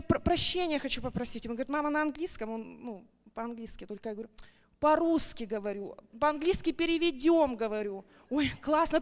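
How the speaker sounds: ordinary delivery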